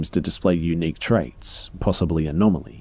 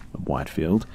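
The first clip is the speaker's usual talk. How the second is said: ordinary delivery, nothing marked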